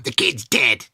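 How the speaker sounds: lightly voiced grim psychic; flat doom-laden medium pronouncement; Sylvia Brown. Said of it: "you could do a brilliant Sylvia Brown".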